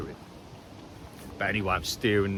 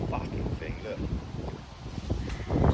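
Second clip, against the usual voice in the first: usual speaking voice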